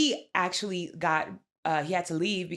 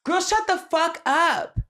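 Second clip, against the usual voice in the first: exasperated outburst; 10 semitones higher, 6 dB louder than usual. Real, not acted. Repeats outburst, slower.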